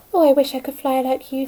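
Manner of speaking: plain reading voice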